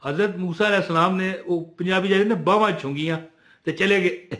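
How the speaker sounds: ordinary delivery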